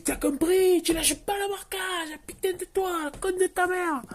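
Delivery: put-on very high, strained Marseille-accented rant; mock Marseille accent. Coach holding a player's head underwater, squealed Marseille accent. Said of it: "je fais l'accent marseillais".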